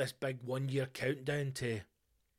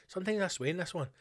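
put-on quick, higher Indian accent, lightly done; mock Indian accent; 5 semitones higher, faster than usual. Podcaster repeating his answer in a light Indian accent. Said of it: "I don't know why I went Indian".

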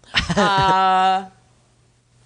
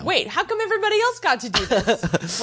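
usual speaking voice

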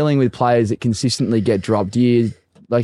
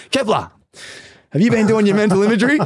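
high, quick mock coach voice; imitating the coach; 9 semitones higher, faster than usual. Coach quizzing Kepler in a meeting, high mock voice. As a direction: rattle it off high like a stern coach. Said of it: "then he just goes".

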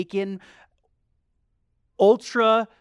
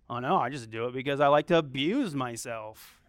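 lower, lightly voiced sarcastic churchgoer; mock listener voice, tongue in cheek; sarcastic mock reply; 6 semitones lower, 3 dB quieter than usual. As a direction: toss it off as a joking fake excuse. Mock answer from congregation.

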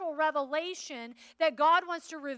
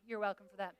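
ordinary talk, nothing marked

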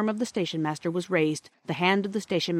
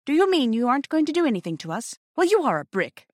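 raised, delighted relief; enthusiastic; 8 semitones higher, 4 dB louder than usual. A narrator's reading. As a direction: thank him with eager surprise. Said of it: "said Peter with enthusiasm".

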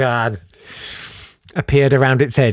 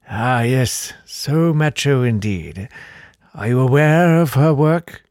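slow, drawn-out creepy host; old Count; 3 semitones higher, slower than usual. Acted. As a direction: savour it like a spooky aristocrat. The old Count discusses music.

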